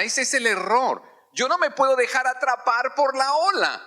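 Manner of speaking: high, urgent preaching voice; fiery preaching. Acted. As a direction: drive it home to the congregation. Preaching climax.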